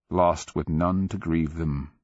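plain reading voice

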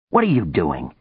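ordinary delivery

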